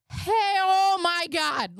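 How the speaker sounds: slow, high and loud, caught off guard; the mother reacts in shock on seeing her daughter; stunned, drawn-out hey, oh my God; shocked gasp. Retold or imitated.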